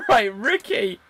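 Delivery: odd character voice. Acted. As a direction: greet Ricky in a strange new voice. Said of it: "that's not how he normally speaks".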